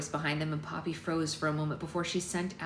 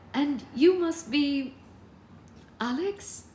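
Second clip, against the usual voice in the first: high, slow, drawn-out greeting; mannered society mother; 9 semitones higher, 5 dB louder, much slower than usual. A narrator's reading. Mother meeting daughter's partner, high mannered greeting. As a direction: swoop up high, pause before the name.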